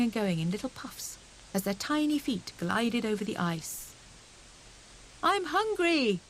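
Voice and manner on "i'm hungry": high, bright calling voice; hare calling. A narrator's reading. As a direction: call out, eager for lunch. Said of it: "called Hare".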